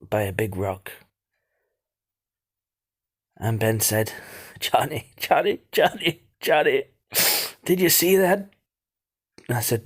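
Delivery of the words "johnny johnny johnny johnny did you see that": Ben panicking, calling to his brother; alarmed call. Retold or imitated. Ben calling Johnny in alarm, urgent and higher.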